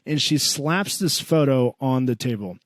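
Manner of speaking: normal talking voice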